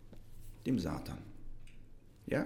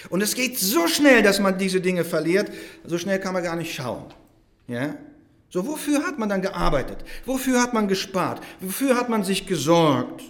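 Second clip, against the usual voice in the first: higher, louder rhetorical questioning; forceful preaching; 6 semitones higher, 5 dB louder than usual. Real, not acted. Preacher warning how quickly possessions are lost, forceful preaching. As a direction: press each question on the congregation.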